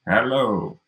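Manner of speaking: retro digitized computer voice; robotic voice. Shown as a demonstration. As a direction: say it like eighties text-to-speech. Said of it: "Like, that very digitized voice".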